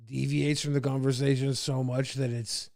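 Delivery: ordinary talk, nothing marked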